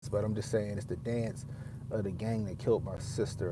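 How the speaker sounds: usual speaking voice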